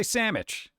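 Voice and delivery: sandwich said as SAM-mitch; colloquial "sammich". Shown as a demonstration. Streamer quoting what people say, sandwich as "sammich". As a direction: say sandwich with an M in place of NDW. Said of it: "people say sandwich".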